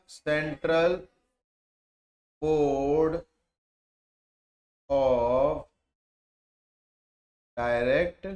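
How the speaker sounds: word by word, long pauses between; stretched words, spaced far apart; slow dictation. Real, not acted. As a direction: draw out each word for note-taking. Teacher dictating as students write it down.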